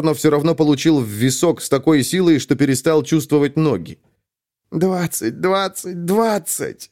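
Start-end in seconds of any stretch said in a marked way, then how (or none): 4.7-6.9 put-on weak, high old voice chanting; a frail old man's incantation; frail shaky old whisper. A narrator's reading. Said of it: "слабым голосом заклинал Таро Тайрен".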